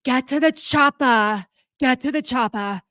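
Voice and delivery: Schwarzenegger movie line. Retold or imitated. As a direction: growl it like the Austrian action star. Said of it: "I'm hearing".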